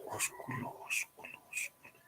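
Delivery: a quiet repeated mutter, trying to recall; puzzled whisper. Pretend. Student puzzling over an unknown word, whispering. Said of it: "tú dices".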